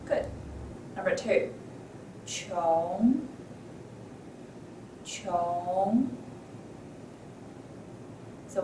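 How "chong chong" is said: "chong" starting low, gliding upward; tone test word. Shown as a demonstration. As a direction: draw "chong" out with a rising end. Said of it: "you tell me what tone is it".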